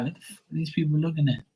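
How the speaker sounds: high, fast fed-up question; exasperated outburst. Retold or imitated. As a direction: spit it out quick and high, baffled. Retold complaint to mom.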